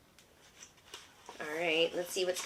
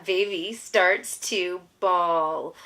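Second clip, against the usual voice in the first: slow, louder storybook line; 5 dB louder, much slower than usual. A narrator's reading. Mother reads the baby's bawling to her toddler, slow and louder. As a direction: stretch it out and lean on starts. Reading the book aloud.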